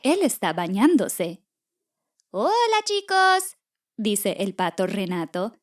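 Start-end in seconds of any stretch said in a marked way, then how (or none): 2.3-3.6 high, drawn-out greeting from a storybook duck; high cartoon duck greeting; friendly duck character. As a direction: call hello slowly, pitched way up.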